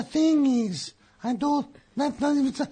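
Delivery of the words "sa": ordinary talk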